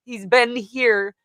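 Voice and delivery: ordinary talk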